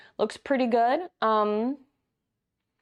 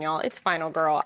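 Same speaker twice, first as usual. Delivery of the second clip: ordinary talk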